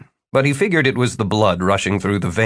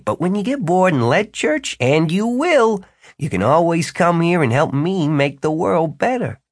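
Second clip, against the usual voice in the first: high, emphatic character voice, selling an offer; high-voiced wizard making his pitch; Jimmy, persuasive wizard; 7 semitones higher than usual. A narrator's reading. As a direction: pitch it high and woo the recruit. Jimmy keeps recruiting Martin.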